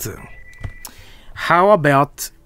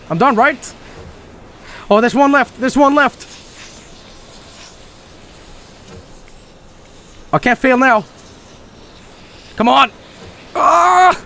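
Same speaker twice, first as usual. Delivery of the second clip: tense scared gamer; 11 semitones higher than usual. Real, not acted. Tense final escape attempt.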